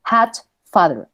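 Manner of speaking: "Hot, father" slowly, each with a pure open A; normal Spanish A. Shown as a demonstration. Teacher shows students the normal A, slow demo. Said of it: "Ahí yo digo la normal".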